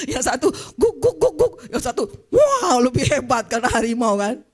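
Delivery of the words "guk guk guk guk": mock dog bark. Dog barking again.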